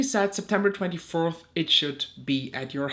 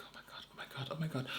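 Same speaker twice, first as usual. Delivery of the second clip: very soft, rapid-fire panic under the breath; whispered dread; 4 semitones lower, 16 dB quieter, much faster than usual. Retold or imitated. Caller bracing for good and bad news, whispered. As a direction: whisper it to yourself, repeating.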